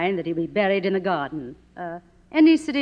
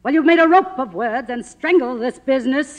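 loud, high, bitter charge; furious accusation; 7 semitones higher, 7 dB louder than usual. Acted. Faded star accusing a screenwriter, loud and bitter. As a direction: blame him for killing pictures.

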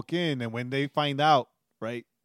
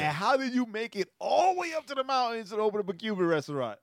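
incredulous question; 9 semitones higher than usual. Retold or imitated. Retold pointed question to owner.

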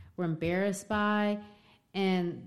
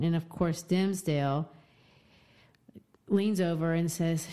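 ordinary talk, nothing marked

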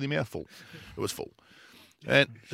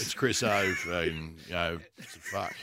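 mumbling Swanee impression; 8 semitones lower, 4 dB quieter than usual. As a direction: mumble it like you couldn't care less. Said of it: "question for you, Swanee".

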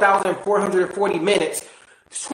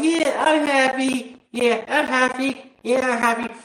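a forced smile in the voice; fake happy; 4 semitones higher than usual. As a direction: pretend cheerfulness, lightly done. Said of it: "We can have fake smiles to everybody".

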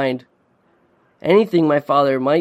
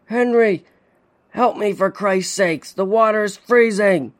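desperate plea; 6 semitones higher than usual. A narrator's reading. Father in the ice begging his son, desperate cry. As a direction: call out for help from freezing water. Father trapped in icy water.

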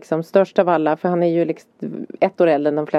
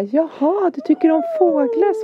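high-pitched, encouraging teacher voice; chirpy teacher; 12 semitones higher than usual. Retold or imitated. Teacher answers Svante who likes birds, chirpy. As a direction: imitate a teacher talking up to a child. Teacher retold greeting a shy pupil.

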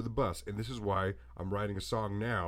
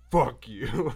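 mock loud brush-off; 2 semitones higher, 8 dB louder than usual. Reddit crowd turning on GameStop, a mock loud brush-off. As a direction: blurt it out louder, dismissive. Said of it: "And then they were like".

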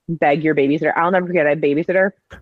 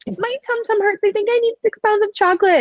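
put-on high, babyish pleading; a high baby voice fishing for chocolate; whiny little kid. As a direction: play the sick kid begging for candy. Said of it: "we could be like".

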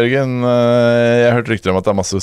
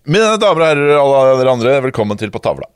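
louder, announcing the show segment; announcer opening; 4 semitones higher, 3 dB louder than usual. Acted. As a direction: open like a show host. (programleder som annonserer innslaget)

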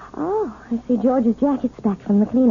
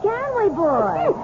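cooing; 8 semitones higher, slower than usual. Acted. Cooing at dog.

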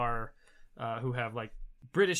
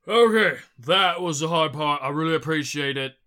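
hearty, relieved giant-kin helper; louder, friendly introduction in character; Belfast; 7 dB louder than usual. Acted. Giant kin introduces himself after the lift, relieved and hearty. Game master plays Belfast.

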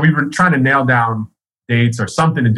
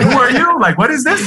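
lightly voiced, much higher bewildered star; 12 semitones higher than usual. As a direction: play a lightly mocked, baffled rapper caught off guard. [name] ambushed at club.

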